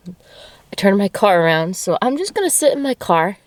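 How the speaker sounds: normal talking voice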